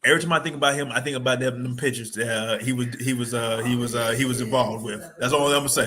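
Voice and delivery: ordinary talk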